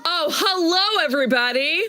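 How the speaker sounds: high, gushing showman welcome; excited host. Acted. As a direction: greet the audience like a giddy game show host. Over-the-top show host greeting.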